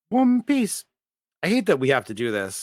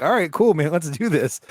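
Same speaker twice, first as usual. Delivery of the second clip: upbeat imitation; 6 semitones higher than usual. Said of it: "I'm like".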